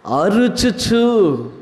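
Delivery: అరుచుచు drawn out syllable by syllable, very slow; stretched slowly. Shown as a demonstration. Preacher teaching the word అరుచుచు, very slow. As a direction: spell out అరుచుచు slowly for the congregation. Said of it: "ఏం చేయొచ్చు?".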